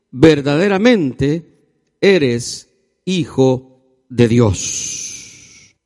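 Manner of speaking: very slow, drawn-out declaration; solemn proclamation. A narrator's reading. Disciples worshipping in the boat, solemn slow proclamation. Climax of the scripture reading.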